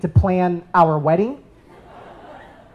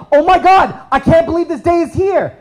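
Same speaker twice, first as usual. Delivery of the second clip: friend's excitement; 8 semitones higher, 4 dB louder than usual. Retold or imitated. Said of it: "And my friend is like".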